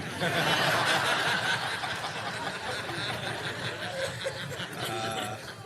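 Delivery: breathy amused laugh; quiet chuckle. Real, not acted. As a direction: laugh softly at the story. Laughing at own story.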